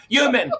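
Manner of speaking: loud, high "Human" with a heavy h; mock the New York "Human" loudly; exaggerated New York human. Shown as a demonstration. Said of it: "It's like".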